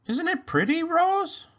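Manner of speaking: rising up high in wonder; delighted child. Acted. Penny shows marble to doll.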